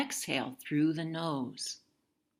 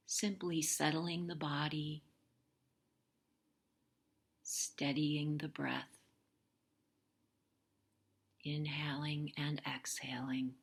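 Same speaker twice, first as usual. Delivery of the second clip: soft whisper; 5 dB quieter than usual. Acted. Yoga teacher settling class.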